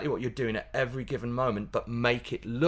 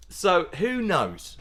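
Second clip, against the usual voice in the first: usual speaking voice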